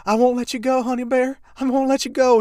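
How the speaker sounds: very high, loud hammed-up plea; mock clinging lover. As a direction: wail it like a soap-opera lover. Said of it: "they're like".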